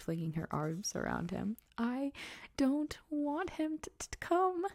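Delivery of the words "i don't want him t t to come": much higher, hammed-up blubbering with stutters; fake sobbing yell. A narrator's reading. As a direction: put on a whiny fake cry to get your way. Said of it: "yelled between huge pretend sobs".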